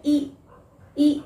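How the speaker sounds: ई held long; a stretched ई. Shown as a demonstration. Teacher showing the long ee sound. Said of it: "थोड़ा सा लंबा sound किया जाता है".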